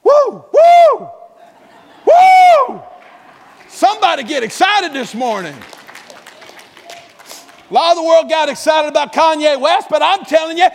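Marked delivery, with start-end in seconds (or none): 0.0-2.8 very high, loud joyful whoops; excited whoop. Real, not acted. Preacher whooping to stir the church, loud whoop. 7.7-10.7 much higher, louder, fast preaching; excited shout. Real, not acted. Preacher rousing the congregation, fast excited shout.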